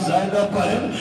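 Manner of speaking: ordinary talk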